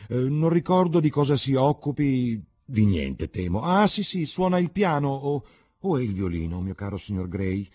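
imitating Lady Brandon.

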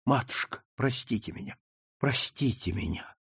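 soft, meek plea for forgiveness; plaintive. A narrator's reading. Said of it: "жалобно проговорила".

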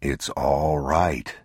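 low, slow, soothing father; father comforting his crying son, low and slow. Acted. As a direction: hold him close and calm him. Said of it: "The man knelt and put his arms around him".

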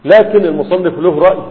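ordinary delivery

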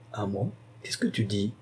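the viceroy wonders under his breath; surprised murmur. Acted.

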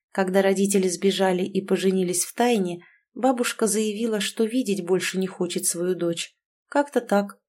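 ordinary delivery, nothing marked

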